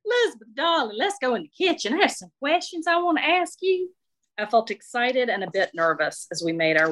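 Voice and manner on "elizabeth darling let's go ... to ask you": high, eager Southern matron; Southern lady voice. Acted. Shirley pulling Elizabeth to the kitchen, high eager Southern lady voice. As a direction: call her over like a curious old friend.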